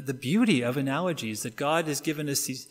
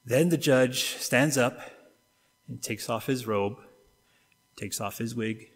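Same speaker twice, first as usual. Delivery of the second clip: normal talking voice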